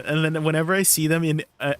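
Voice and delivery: normal talking voice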